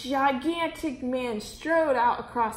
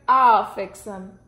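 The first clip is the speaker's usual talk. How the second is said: louder, slow menacing promise; the duke vows to deal with the boy, loud and slow; grim threat; 2 semitones lower, 8 dB louder, slower than usual. A narrator's reading. Grand Duke threatening the boy, loud and slow. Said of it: "said the Grand Duke Wilfred".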